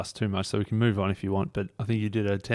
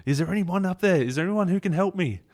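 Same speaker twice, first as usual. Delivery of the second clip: higher, mock desperate call upward; retold yell; 10 semitones higher than usual. A narrator's reading. Man dangling from a cliff tree calls for help, mock yell, higher. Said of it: "he yells out".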